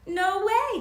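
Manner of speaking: loud high burst of disbelief; loud, high exclamation; declared. A narrator's reading. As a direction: belt out no way. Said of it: "declared Louise".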